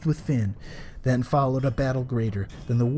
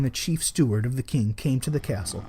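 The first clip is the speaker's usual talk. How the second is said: plain reading voice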